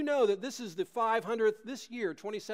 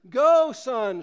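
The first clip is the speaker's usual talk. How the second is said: loud cry to her son; 6 semitones higher, 12 dB louder than usual. A narrator's reading. Mother sends her son onto the wagon, crying out loud. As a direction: send him off with all her strength. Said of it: "she cried".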